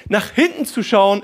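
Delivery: ordinary delivery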